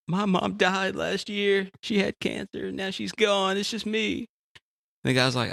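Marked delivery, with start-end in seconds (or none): 0.1-4.2 high, slow, mournful self-pity; mournful jumper. Retold or imitated. Jumper tells the passerby his mother died, high mournful. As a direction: mope through the sad list.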